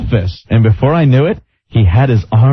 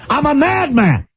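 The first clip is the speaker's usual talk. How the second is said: Regis impression; 5 semitones higher than usual. Mocking Regis on air.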